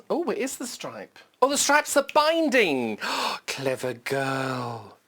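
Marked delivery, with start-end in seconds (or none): 3.0-5.0 surprised gasp. Real, not acted. Admiring the binding.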